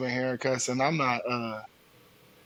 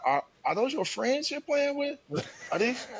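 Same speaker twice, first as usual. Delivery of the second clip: much higher, disbelieving question; incredulous parent; 10 semitones higher than usual. Acted. Parent asking son about his gaming friends, incredulous. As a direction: pitch way up in mock disbelief.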